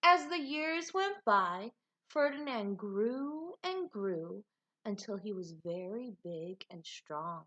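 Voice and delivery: lively, expressive storybook reading; animated read-aloud with big swoops in pitch; reading to children. A narrator's reading. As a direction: read it like a picture book for a class. Teacher reads picture book aloud.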